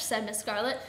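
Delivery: usual speaking voice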